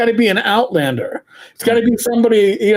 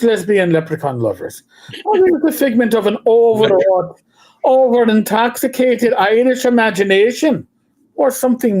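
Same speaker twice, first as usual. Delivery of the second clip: theatrical Irish brogue answering-machine message; fake Irish brogue; 3 semitones higher than usual. Shown as a demonstration. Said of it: "turn on the Irish brogue".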